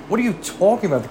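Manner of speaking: usual speaking voice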